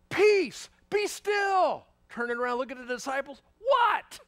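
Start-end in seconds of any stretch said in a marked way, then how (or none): 0.1-1.9 high, loud command to the storm; commanding call. Acted. Jesus calms the storm. 3.6-4.2 high, loud disbelieving what; stunned at their lack of faith; incredulous shout. Acted. Jesus looks at disciples.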